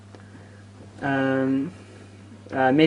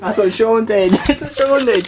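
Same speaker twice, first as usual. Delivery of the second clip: laughing; 7 semitones higher, 6 dB louder than usual. Real, not acted. Laughing while retelling.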